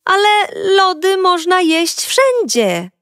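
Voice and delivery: ordinary delivery, nothing marked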